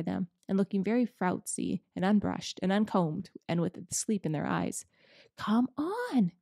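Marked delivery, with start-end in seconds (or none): 5.4-6.3 higher, delighted beaver call; nearly dancing with delight; excited cry. Acted. Mister Beaver calling the children up the bank, delighted cry. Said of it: "cried Mister Beaver".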